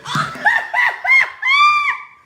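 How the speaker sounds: very high, loud shrieking laugh; screaming cackle of laughter. Real, not acted. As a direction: howl with laughter. Big laugh.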